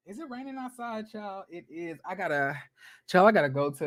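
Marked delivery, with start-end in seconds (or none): 0.1-2.6 much softer, dropped-voice aside; quiet aside. Real, not acted. Asking viewers about the rain, quiet aside. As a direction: lean in and drop it to a murmur.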